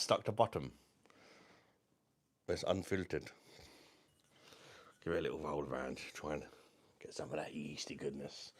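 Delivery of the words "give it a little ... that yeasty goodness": whispered, savouring aside; hushed whisper. Real, not acted. Hushed aside while rolling the beer.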